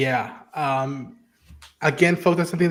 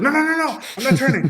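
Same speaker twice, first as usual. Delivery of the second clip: high, frantic pleading no no no; voicing Ellie in a high panic; panicked denial; 12 semitones higher, 4 dB louder, faster than usual. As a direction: insist in a panic you are not turning. Ellie panicking, insisting not turning.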